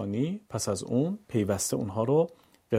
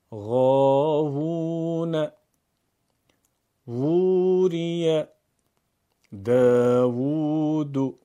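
slow, louder chanted words; Quran recitation; 4 dB louder, much slower than usual. Shown as a demonstration. Teacher recites example words with small vav. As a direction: stretch each long vav. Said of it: "ببینید کلمات رو".

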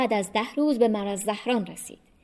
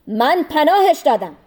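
raised, higher-pitched intervention; urgent protest; 5 semitones higher, 6 dB louder than usual. A narrator's reading. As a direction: step in firmly to shield him. Abbas steps in to protect him. (با صدای بلند و قاطع)

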